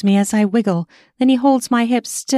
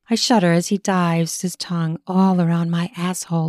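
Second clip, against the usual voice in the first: usual speaking voice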